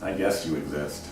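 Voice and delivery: bored brush-off. Retold or imitated. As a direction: dismiss her like she barely exists. Uninterested crush voiced.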